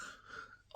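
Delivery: soft unvoiced laugh; quiet laugh. Real, not acted. Recalling the charges, a soft breathy laugh. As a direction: breathe out a silent chuckle. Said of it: "when I laughed them all off".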